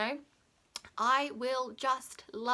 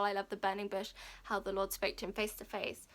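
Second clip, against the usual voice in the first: ordinary talk, nothing marked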